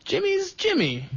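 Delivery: high-pitched retold quote; Adam Carolla quote. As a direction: lift the quote well above your own pitch. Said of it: "but he says".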